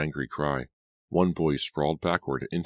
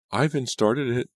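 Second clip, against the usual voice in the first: put-on girl muttering; 9 semitones higher, 6 dB louder than usual. A narrator's reading. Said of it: "I heard a girl mutter".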